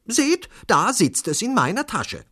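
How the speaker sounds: high, loud claim; high-pitched woman passenger insisting; insistent woman. A narrator's reading. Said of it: "behauptete eine Frau".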